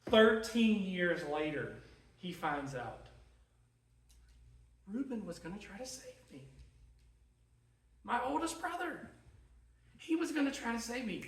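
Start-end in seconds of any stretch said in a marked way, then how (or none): 4.9-11.2 softly acted dawning realization, lifting in pitch; quiet, mildly stunned disbelief; hushed realization. Joseph finding out his oldest brother tried to save him, hushed realization.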